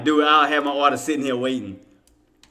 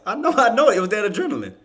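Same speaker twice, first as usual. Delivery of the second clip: usual speaking voice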